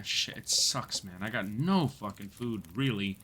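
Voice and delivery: hushed muttering under his breath, half whispered; low disgruntled mutter about having no food. Real, not acted. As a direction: grumble it quietly to yourself. Muttering to himself at night.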